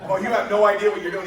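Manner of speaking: loud, high mock realisation; retold disbelief. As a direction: blurt out the dawning horror, played for laughs. Realises his recruiter is clueless.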